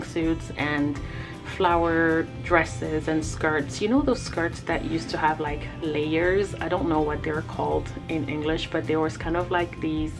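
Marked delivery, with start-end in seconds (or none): none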